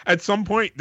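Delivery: ordinary talk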